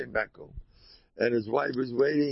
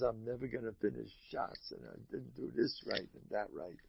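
quiet, mumbled self-reproach; a despairing scholar berating himself; self-beating lament; 4 semitones lower, 13 dB quieter than usual. Retold or imitated. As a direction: mutter it like a man beating himself up. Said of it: "he knocks himself to pieces".

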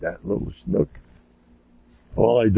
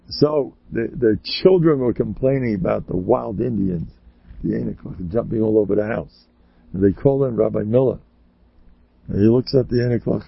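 normal talking voice

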